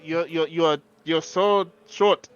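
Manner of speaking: ordinary talk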